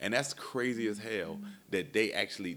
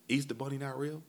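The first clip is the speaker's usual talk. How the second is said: put-on much higher kid voice, disbelieving; daughter's question; 7 semitones higher than usual. Dad voicing daughter learning about Easter Bunny. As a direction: imitate a stunned little girl.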